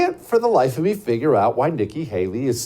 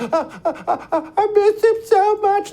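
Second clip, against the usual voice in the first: hammed-up sobbing in a very high pitch; exaggerated high, blubbering mockery; mock crying; slower than usual. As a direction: imitate someone breaking down in tears. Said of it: "You can't cry and be the commander-in-chief like that".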